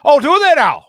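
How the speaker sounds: high, loud hammed-up Irish bloke; mock delighted Irish bartender teasing; mock Irish guy. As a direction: ham up the cheeky Irish barman. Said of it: "He goes".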